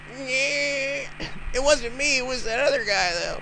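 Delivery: high, slow nerd whine; high nerdy voice. Acted. Nerdy guy voice.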